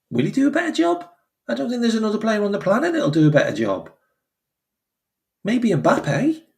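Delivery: high, fast, disbelieving outburst; incredulous rant. Real, not acted. Fan ranting at Newcastle's choice, high incredulous outburst. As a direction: fire it off in disbelief.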